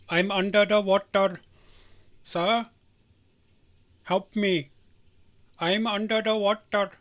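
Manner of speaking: hammed-up pleading in a put-on accent; funny-video imitation. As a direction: imitate a viral cry for rescue. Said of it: "That's a funny video".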